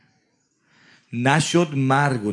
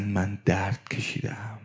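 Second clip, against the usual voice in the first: whisper; 8 semitones lower, 9 dB quieter, slower than usual. Acted. Moses tells his death pain.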